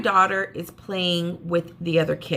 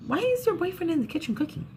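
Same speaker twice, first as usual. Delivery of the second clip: soft, rapid, high-pitched mimicry; little high-pitched voice; 10 semitones higher, 5 dB quieter, much faster than usual. Man nosily questioning the storyteller about her boyfriend, little high-pitched voice. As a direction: imitate him in a small, high, quick voice. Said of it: "with this, like, little high-pitched voice".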